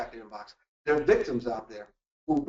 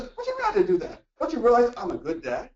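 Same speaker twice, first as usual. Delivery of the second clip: mock outrage jumping much higher; indignant protest; 8 semitones higher than usual. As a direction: defend yourself, offended and incredulous. Acting himself walking into argument.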